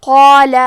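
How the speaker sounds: "قال" said loud with a thick qaf and a heavy long alif. Shown as a demonstration. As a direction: push out "قال" full and heavy from the throat. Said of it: "تو ہم یہ دونوں حرف کو بھاری آواز میں پڑھیں گے". ("قال" کو بھاری، موٹی آواز میں)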